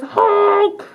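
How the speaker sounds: high, loud honk; goose honk. Acted. Librarian honking as the odd pigeon for the kids. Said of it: "this guy who says".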